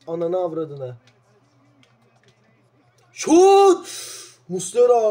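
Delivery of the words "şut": loud, high yell of the word; shouted shot. Real, not acted. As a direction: shout it as the ball flies. Big chance. (yüksek sesli, tiz bir bağırış)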